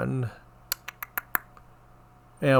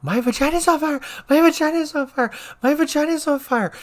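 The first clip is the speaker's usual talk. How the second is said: mock scream. Nurse imitating the patient screaming from her bed. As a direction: shriek it like a panicked old patient. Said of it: "She starts screaming".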